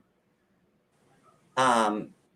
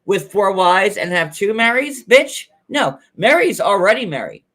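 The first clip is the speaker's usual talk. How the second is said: furious sassy rant; 4 semitones higher, 3 dB louder than usual. Retold or imitated. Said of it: "Christine's like".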